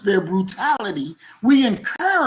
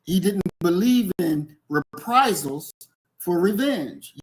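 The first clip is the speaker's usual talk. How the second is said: normal talking voice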